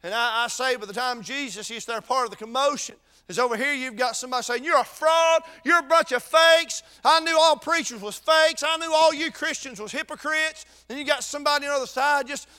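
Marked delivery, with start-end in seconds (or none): 4.6-10.6 raised, higher jeering accusation; louder, higher mock scorn at the preacher; mock accusing heckler. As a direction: throw the insults like a scornful heckler. Preacher voicing a scoffer in crowd.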